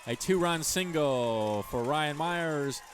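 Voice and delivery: loud, high play-by-play burst; excited home run call. Real, not acted. Announcer to radio listeners, roaring the big hit. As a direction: call the hit like the crowd's on its feet.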